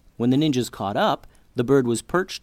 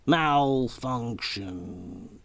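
meek robot voice; much slower than usual. A narrator's reading. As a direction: buzz it out weakly, winding down. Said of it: "the robot buzzed meekly".

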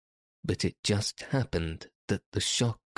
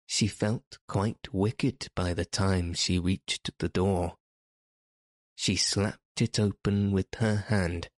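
plain reading voice

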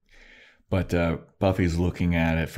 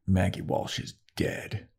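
mock grim whisper; hushed; 5 dB quieter, slower than usual. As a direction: breathe out the bad news flat and quiet. Grim Riley quote, hushed.

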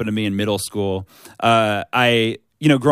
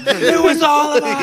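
high, loud and slow with a grin; gleeful mock reveal; 5 dB louder, slower than usual. Prankster gloating to the tricked friend, high slow taunt. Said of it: "You're like".

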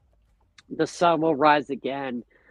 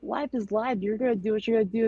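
higher and fast, casual laid-back dude; voicing the deer; 9 semitones higher than usual. Retelling how the deer seemed to reassure him, higher and fast. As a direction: let the deer talk like a chill buddy brushing off worries. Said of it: "I saw my deer... just looking at me, like".

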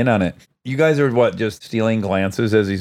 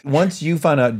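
usual speaking voice

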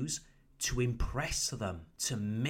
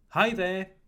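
"Hi there" pitched high and louder; warm greeting intonation; 5 semitones higher, 5 dB louder than usual. Shown as a demonstration. English teacher models a greeting for learners, warm intonation. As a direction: lift "Hi there" brightly high, as a friendly greeting. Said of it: "It sounds warmer".

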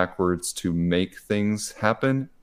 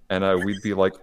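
normal talking voice

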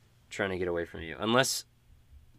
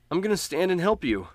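put-on higher, determined boyish voice; stubborn kid brother; 10 semitones higher, 5 dB louder than usual. Sly insists on staying with his big brother, high and determined. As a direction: refuse to leave him behind. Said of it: "he says".

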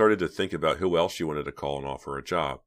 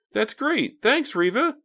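put-on squealing high, loud girlish "Really"; excited; 7 dB louder, slower than usual. A narrator's reading. Lisa hearing the job news, thrilled and squealing. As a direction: burst with delight. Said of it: "couldn't hide her excitement".

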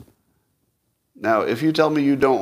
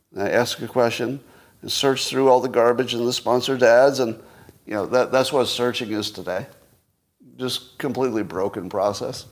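ordinary delivery

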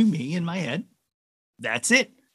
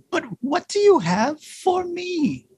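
pleading reindeer; slower than usual. A narrator's reading. Reindeer presses Santa for a gift, gentle plea. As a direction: ask in a small, swooping voice.

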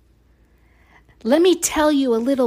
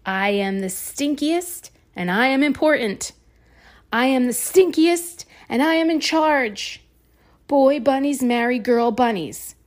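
rising, louder pompous boast; 5 semitones higher, 4 dB louder than usual. Acted. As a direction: puff up like a self-important bully. Stink bug boasting, rising.

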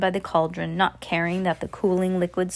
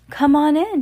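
high, louder sing-song coo; 8 semitones higher, 6 dB louder than usual. A narrator's reading. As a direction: coo sweetly to lure them in. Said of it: "she cooed".

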